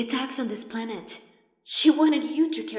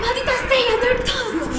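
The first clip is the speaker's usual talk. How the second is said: much higher, loud frantic cry; cursing loudly in the middle of an attack; alarmed shouting; 12 semitones higher, 6 dB louder than usual. Acted. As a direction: yell it in panic. Under attack, panicking.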